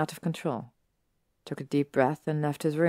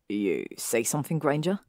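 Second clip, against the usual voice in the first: Draco inquiring. Acted. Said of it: "raised an eyebrow and inquired".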